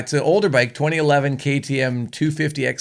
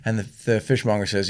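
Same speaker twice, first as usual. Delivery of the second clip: usual speaking voice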